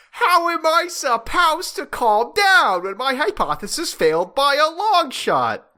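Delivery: high, loud hammed-up outburst; exploding in frustration. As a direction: yell it like a frustrated scientist. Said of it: "He exploding".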